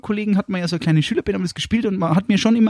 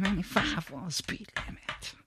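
much softer, murmured echo of the lyric; soft muttered lyric; 15 dB quieter than usual. Acted. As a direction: hum it back under the breath. Co-host echoes lyric quietly.

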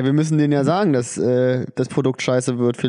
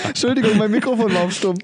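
higher, acted apology for muted mic; pretend late caller; 6 semitones higher than usual. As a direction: play the guy whose mic was muted. Late joining joke.

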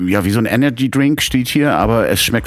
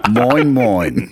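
words pushed out as a burp; 5 semitones higher than usual. Pretend. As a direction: burp out a greeting. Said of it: "rülpst deiner Frau ganz hart erstmal einen entgegen". (gerülpster Gruß)

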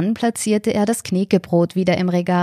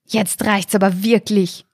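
a touch of exasperation, voice changed; mildly fed up, snorting it out; understated exasperated outburst; 2 semitones higher than usual. A narrator's reading. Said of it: "schnaubte Michaela".

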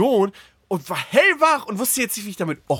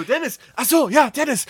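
nachgemachter Schlafwandler; 8 semitones higher than usual. Retold or imitated. Verwirrten Freund nachgemacht.

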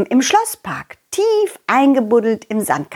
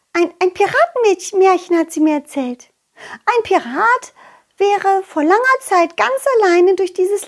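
put-on excited butterfly girl; 10 semitones higher than usual.

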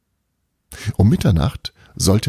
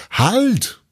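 a sharp, high, alarmed cry of stop; sudden shout. Acted. Belana shouting at Bruno to stop sawing, a high alarmed yell. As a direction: yell it high to freeze him mid-cut. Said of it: "schrie Belana".

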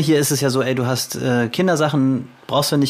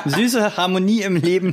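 laughing; 7 semitones higher than usual. Real, not acted. Laughing joke about sweets.

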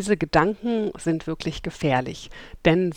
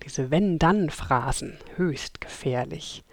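ordinary delivery

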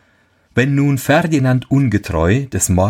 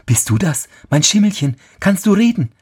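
astonished; 11 semitones higher than usual. Acted. Amazed the horse speaks.

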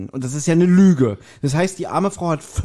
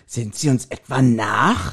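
reproachful; 5 semitones lower, slower than usual. Retold or imitated. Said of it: "wie er das sagt, so".

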